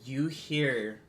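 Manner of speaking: ordinary delivery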